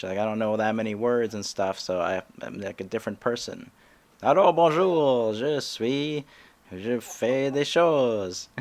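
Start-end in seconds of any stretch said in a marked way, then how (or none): 4.2-8.5 slow, higher French with a big drawn-out start; halting French persona. Shown as a demonstration. Comic shows his French-speaking other self, slow halting French. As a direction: stumble grandly through beginner French. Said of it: "when I start speaking French".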